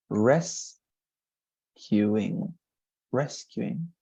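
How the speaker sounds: rescuing said carefully, rising at the end; pronunciation demo. Shown as a demonstration. Teacher repeating rescuing for Shirley, careful. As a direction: model rescuing slowly. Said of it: "in this case, it's".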